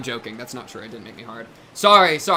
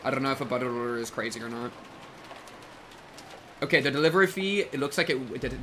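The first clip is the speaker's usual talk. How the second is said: ordinary talk, nothing marked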